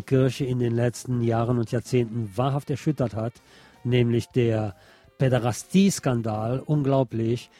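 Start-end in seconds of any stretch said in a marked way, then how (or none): none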